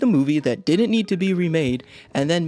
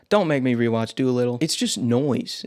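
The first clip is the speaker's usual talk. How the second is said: usual speaking voice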